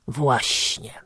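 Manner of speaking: low, soft tramp voice; vagrant. A narrator's reading. Vagrant agreeing, low and soft. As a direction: mumble agreement like a drifter.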